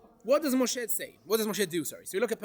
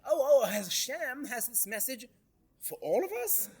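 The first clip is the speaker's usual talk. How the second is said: mock disbelief; 3 semitones higher than usual. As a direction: act out astonishment, pitch climbing at the end. Acting out disbelief at Moshe's message.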